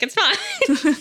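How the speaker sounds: laughing. Real, not acted. Laughing at own story.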